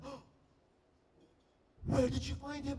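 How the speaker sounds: high-pitched amazed gushing; freaking-out friend. Retold or imitated. Friend John seeing the star, high excited freak-out. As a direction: ask it in high, starstruck disbelief. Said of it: "they're both freaking out".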